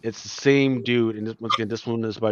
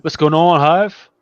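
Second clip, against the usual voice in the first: excited greeting; 7 semitones higher, 10 dB louder, slower than usual. Real, not acted. Host greets guest joining stream.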